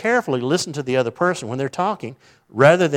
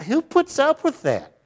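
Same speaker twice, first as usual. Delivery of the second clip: indignant rhetorical question; 13 semitones higher, slower than usual. Real, not acted.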